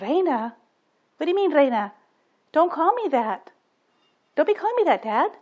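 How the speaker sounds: rising mock outrage of a little girl; offended little girl. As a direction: protest like an upset six-year-old. Six-year-old offended by the nickname.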